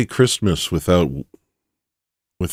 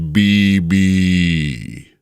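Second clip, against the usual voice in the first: drawn-out "Bibi", held long and sliding down at the end; flying nuke cry; 3 dB louder than usual. Acted. Imagining the nuke flying through the air, long missile cry. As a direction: stretch it out like a falling bomb. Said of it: "nuke's flying through the air going".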